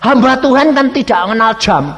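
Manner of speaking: high, loud and fast insisting; insisting neighbour. Retold or imitated. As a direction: push him, fast and loud. (tetangga mendesak, cepat dan keras)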